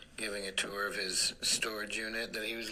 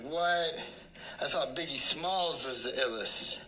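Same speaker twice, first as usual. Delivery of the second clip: mock surprise; 6 semitones higher, 4 dB louder than usual. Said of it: "I'm like".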